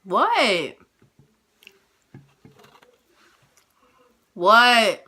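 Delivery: loud call to another room. Real, not acted. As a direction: shout back at someone out of sight. Calling back to someone.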